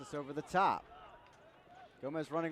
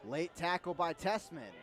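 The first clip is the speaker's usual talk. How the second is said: normal talking voice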